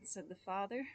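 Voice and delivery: usual speaking voice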